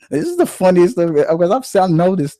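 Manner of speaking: can't contain the excitement; excited high voice. Real, not acted. Laughing excitedly about the clip.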